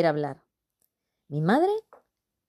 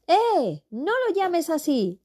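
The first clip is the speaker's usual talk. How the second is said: shouting; 11 semitones higher, slower than usual. Acted. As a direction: yell it from across the room. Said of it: "gritó desde lejos Albertito".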